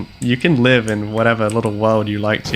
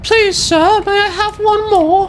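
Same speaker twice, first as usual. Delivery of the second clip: very high, loud put-on begging; pleading beggar voice; 4 dB louder, slower than usual. Player playfully begs sir for one more, pleading voice. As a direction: plead in a squeaky mock falsetto.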